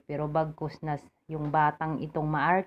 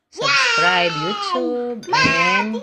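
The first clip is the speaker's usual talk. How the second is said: much higher and louder, calling out to viewers; excited outro shout; 10 dB louder, much slower than usual. Acted. Vlogger shouting to her viewers to subscribe, excited. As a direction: sing out the sign-off like a cheerleader.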